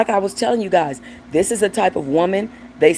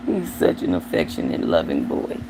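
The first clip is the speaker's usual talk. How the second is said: much lower, soft cooing to the dog; 14 semitones lower, 4 dB quieter than usual. Real, not acted. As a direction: croon it to your pet, lower.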